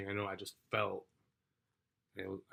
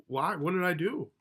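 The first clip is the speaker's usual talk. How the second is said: high, loud bewildered protest; alarmed disbelief; 9 semitones higher, 7 dB louder than usual. Retold or imitated. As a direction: plead innocence in a startled, raised voice. Retold shock at being arrested.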